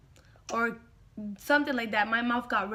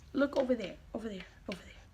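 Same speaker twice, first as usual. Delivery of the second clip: softer aside, pointing away; hushed aside; 2 semitones lower, 9 dB quieter, much faster than usual. Real, not acted. Hushed aside to someone off camera. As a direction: drop the voice telling someone off camera.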